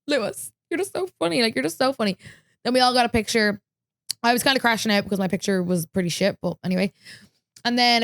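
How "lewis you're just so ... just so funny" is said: high, giggly fangirl gushing; gushing fan. Retold or imitated. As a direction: swoon over his joke. Fan laughing at idol's joke.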